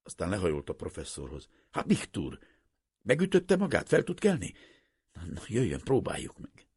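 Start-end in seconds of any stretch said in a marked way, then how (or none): 1.7-6.7 lightly voiced worried helper, higher and louder on the questions; concerned merchant. A narrator's reading. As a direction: coax the hurt man up gently. Merchant helps the fallen professor.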